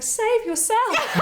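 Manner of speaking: dramatic plea. Retold or imitated. Teacher recalling the wasp attack in a melodramatic cry. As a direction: play it as a hammy dying hero. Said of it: "it was very dramatic".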